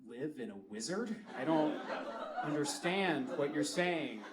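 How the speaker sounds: low, soft bafflement; imitated confused onlooker; confused stranger. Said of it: "they're just looking at me confused".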